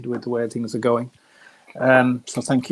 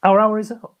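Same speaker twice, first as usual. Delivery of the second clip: playful echo; 10 semitones higher, 6 dB louder than usual. Real, not acted. Echoes host's "our hour is up" rhyme.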